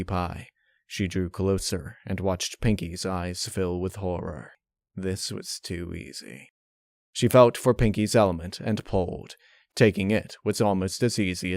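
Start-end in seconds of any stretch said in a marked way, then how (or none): 5.0-6.5 dark hush. A narrator's reading. Dark gloating thought.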